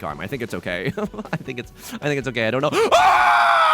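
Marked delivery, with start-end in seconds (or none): 2.7-3.7 high, loud delighted shriek; excited shriek. Real, not acted. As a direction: squeal with joy. Thrilled by new mic arm.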